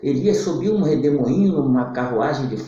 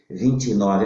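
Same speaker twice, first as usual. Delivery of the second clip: normal talking voice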